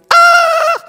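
high shrill puppet scream; doll scream. Acted. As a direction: shriek in fright. Doll sees itself in mirror.

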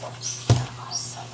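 slow, hushed whisper; drawn-out whisper; whispered aside. Real, not acted. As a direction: whisper it slowly under your breath. Awed aside to viewers.

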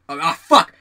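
acted burst of loud cursing; loud curse. Jerry spots the hippie walking across, loud acted curse. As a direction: blurt it out loud, imitating a startled coworker.

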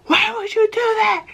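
high, shrill-pitched imitated scolding mom; angry mom. Mom scolding her son while whipping him, high mock voice.

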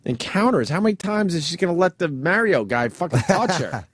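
ordinary delivery

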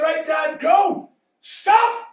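shouting to Dad, rising high; a boy shouting from the rope, pitch climbing; calling out. Retold or imitated. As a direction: yell the signals to the car.

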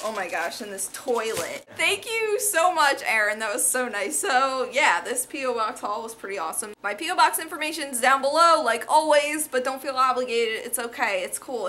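normal talking voice

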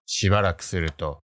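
ordinary delivery

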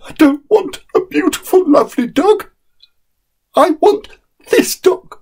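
put-on very high, pleading child's voice; a small boy clinging to his choice; insistent little boy. Boy insists against nurse.

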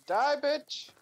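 high, loud yell; battle yell. Real, not acted. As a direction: scream it while attacking. Attacking in game.

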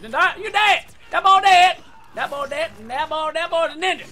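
high, loud gamer hype; excited yelling. Real, not acted. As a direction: hype up the kill. Excited gaming kill.

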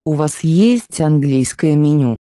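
ordinary delivery

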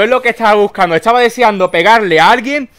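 ordinary delivery

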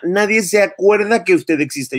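high, scornful put-down; scornful retort. Real, not acted. Vlogger telling off the woman, high scornful jab. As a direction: cut her down with contempt.

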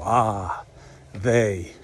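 usual speaking voice

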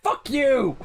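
high, exasperated outburst; exclaimed curse. Real, not acted. Curses at the game mid-play.

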